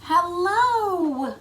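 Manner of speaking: high bright greeting call; cheery hello. Pretend. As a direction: greet the astronauts like the crew. Crew greeting astronauts.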